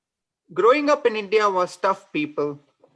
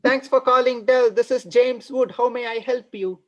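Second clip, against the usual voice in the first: call center agent; 6 semitones higher than usual. Retold or imitated. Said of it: "I find a relative".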